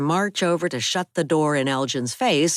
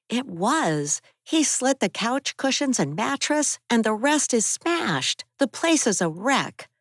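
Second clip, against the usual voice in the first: put-on higher young woman's voice, upset; raised, higher-pitched Abby telling of the break-in; Abby's voice; 10 semitones higher than usual. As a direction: voice Abby, shaken by the attack.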